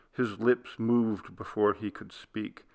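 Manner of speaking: ordinary delivery, nothing marked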